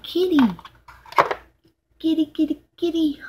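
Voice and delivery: high-pitched little sing-out; high kitty chirp. Real, not acted. Vlogger delighted by a kitty in her box, chirpy. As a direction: chirp it high, in short repeats.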